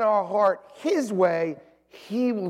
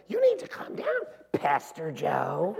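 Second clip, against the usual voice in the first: mock her chiding, pitched way up; Alicia scolding; 2 semitones lower, 4 dB quieter, slower than usual. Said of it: "Alicia comes in".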